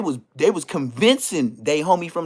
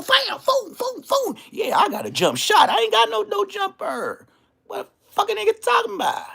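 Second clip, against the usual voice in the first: mocking voice. Mocking, high put-on voice.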